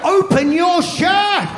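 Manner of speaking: high, slow, shouted repeat of the order; mock yelled midwife order. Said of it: "repeats the order".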